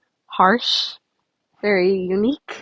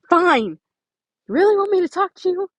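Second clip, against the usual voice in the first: angry snap; 8 semitones higher, 5 dB louder than usual. Acted. Nico snaps back in quarrel.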